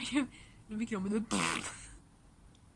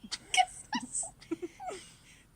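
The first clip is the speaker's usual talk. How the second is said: choked laugh; 4 dB louder than usual. Real, not acted. Laughing with water in mouth.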